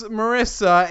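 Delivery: normal talking voice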